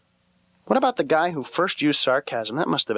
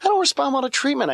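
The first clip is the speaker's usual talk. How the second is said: don't respond said much higher, punchline-style; repeated higher for the punchline; 11 semitones higher than usual. Shown as a demonstration. Repeating his own line to the audience, pitched way up. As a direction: lift the whole line way up as a deadpan punch. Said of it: "is that".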